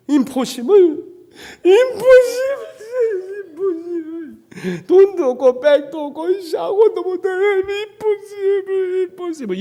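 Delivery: high, loud tearful wail of impossible; crying. Retold or imitated. Young man crying his way down to church, despairing. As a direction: sob it out slowly, stretched and breaking. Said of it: "그럼 한참 울었어요".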